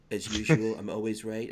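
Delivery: ordinary talk, nothing marked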